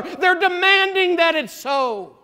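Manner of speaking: high, loud, drawn-out insistence; emphatic preaching shout. Real, not acted. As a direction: keep shouting it at full preaching force.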